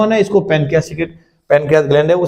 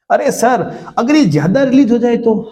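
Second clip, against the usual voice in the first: higher, eager student asking; 6 semitones higher than usual. Acted. Teacher plays a student asking about excess growth hormone, eager. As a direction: pipe up like a curious pupil.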